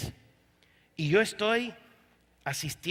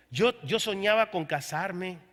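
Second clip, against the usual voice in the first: normal talking voice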